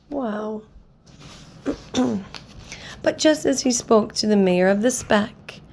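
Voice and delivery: ordinary delivery, nothing marked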